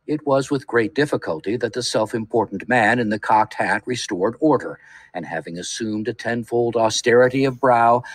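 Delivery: ordinary delivery, nothing marked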